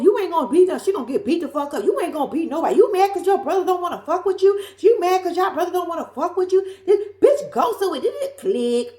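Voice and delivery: hammed-up girlfriend going off; mock phone shouting. Monique on the phone yelling at his sister, a mock taunting yell.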